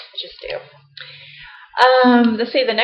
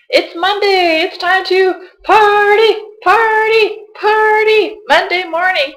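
much higher and louder, slow mock-ecstatic party chant; mock party hype; 11 semitones higher, 6 dB louder, slower than usual. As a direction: ham up fake Monday cheer.

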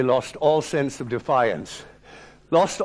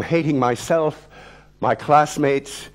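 plain reading voice

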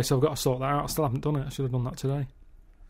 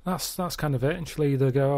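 ordinary talk, nothing marked

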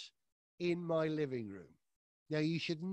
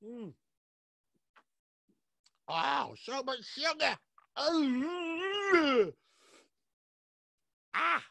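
sugar shock; 7 semitones higher, 6 dB louder, much slower than usual. Real, not acted. Tasting the fizzy drink.

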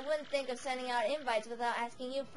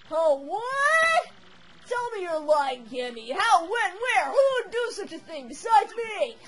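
loud, high alarmed outcry; shocked outburst; 5 semitones higher, 6 dB louder than usual. Acted. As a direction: react to terrible news. Bowser shocked at kidnapping.

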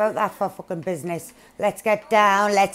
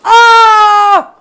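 loud high startled cry; shocked shout; 12 semitones higher, 19 dB louder than usual. Real, not acted. Getting ambushed in the game, shocked shout. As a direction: yelp it out, caught off guard.

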